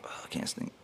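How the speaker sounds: a breathless, whispered groan of exhaustion; barely a breath, spent and dizzy; exhausted whisper. Retold or imitated. Runner collapsing on the climb, whispering in exhaustion.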